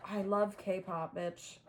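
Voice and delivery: ordinary talk, nothing marked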